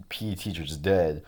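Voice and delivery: ordinary talk, nothing marked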